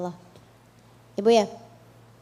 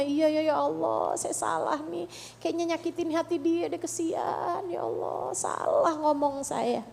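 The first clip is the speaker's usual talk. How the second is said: soft, higher-pitched self-reproach; acted remorse; 4 semitones higher, 5 dB quieter than usual. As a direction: imitate someone quietly regretting their words. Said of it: "kamu kecewa kepada dirimu karena salah ngomong".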